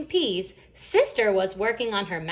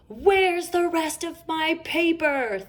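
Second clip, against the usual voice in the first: angry Papa Bear; 10 semitones higher, 5 dB louder, slower than usual. A narrator's reading. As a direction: boom it out like an angry father bear. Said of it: "thundered an angry Papa Bear".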